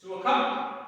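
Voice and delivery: loud come command to the dog; loud recall command. Real, not acted. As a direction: call the dog over firmly. Calling dog.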